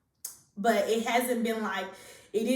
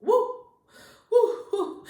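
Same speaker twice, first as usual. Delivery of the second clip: high, winded "woo" puffs; demonstrating shortness of breath; 12 semitones higher, 6 dB louder, slower than usual. Shown as a demonstration. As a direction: puff out little whoops as if winded. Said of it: "shortness of breath, which is".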